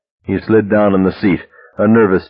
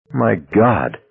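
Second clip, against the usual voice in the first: sickly. Acted. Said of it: "Amelia said, sickly".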